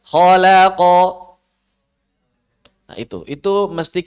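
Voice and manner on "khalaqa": Khalaqa with heavy kha, thin lam, heavy qaf; loud Khalaqa, lam kept thin; thick kha, thin lam. Shown as a demonstration. Teacher models Khalaqa for students to copy. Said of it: "Kha tebal, lam tipis, qaf tebal".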